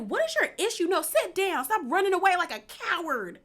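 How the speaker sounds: high-pitched mock scolding; imitated angry demand. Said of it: "So then Fatum is like".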